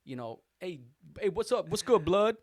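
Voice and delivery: high, loud street greeting; regulars greeting him. Retold or imitated. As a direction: hail the clerk like a buddy. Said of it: "I got every name".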